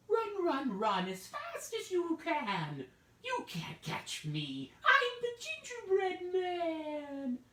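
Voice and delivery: very high, slow sing-song taunt; taunting gingerbread man. Acted. Gingerbread man taunting the old couple to chase him. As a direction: squeal the chant at his chasers.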